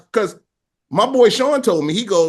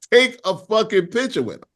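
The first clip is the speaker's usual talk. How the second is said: usual speaking voice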